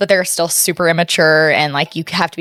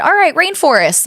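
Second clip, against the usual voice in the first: high teacher call-out; 10 semitones higher than usual. Retold or imitated. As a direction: call it out to the class. Teacher calling out to class.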